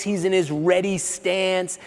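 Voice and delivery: ordinary delivery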